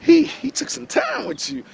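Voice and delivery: high, squealing ooh and a slow, awed oh my God; gushing admiration. Retold or imitated. A man imitating someone stunned by a beautiful woman, high and gushing. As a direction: gush over her way up high like a starstruck fan. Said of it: "make you say".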